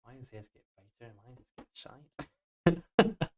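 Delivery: put-on quiet, playful Scottish accent. Developer comparing shaders to another's, playful Scottish accent. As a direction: grumble softly in a light Scottish accent. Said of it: "I'm doing a Scottish accent".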